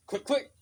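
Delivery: high, loud mouth-made clicks of a gun; imitated gun clicks, high and loud; gun click noise. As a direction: snap out the gun sound. Mimics gun cocking.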